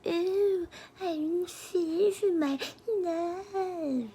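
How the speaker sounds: high, slow mocking falsetto of the woman behind the door; mimicking her taunting through the door. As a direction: sneer at her in a squeaky put-on voice. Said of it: "you could hear it through the door".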